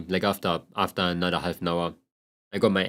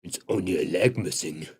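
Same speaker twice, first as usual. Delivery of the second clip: lower, quieter, shrugging it off; tough old self; 5 semitones lower, 4 dB quieter than usual. Retold or imitated. Mocking his old work self, lower and shrugging. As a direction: play it down like nothing hurts. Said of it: "it would probably be like".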